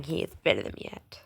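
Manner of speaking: soft breathy whisper; bragging whisper. Acted. Bragging to viewers about beating Skeleton, whispered. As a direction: whisper the brag like a secret.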